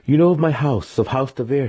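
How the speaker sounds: high, loud, affronted; a proud noble youth, indignant. A narrator's reading. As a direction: puff up with offended pride. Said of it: "he said, indignant".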